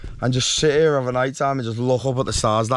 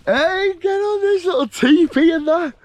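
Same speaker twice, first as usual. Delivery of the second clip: high, slow and loud, thrilled by the teepee; drawn-out delighted shout at the tent; excited call; 5 dB louder than usual. Real, not acted. Showing off the teepee.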